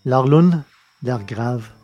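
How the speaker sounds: plain reading voice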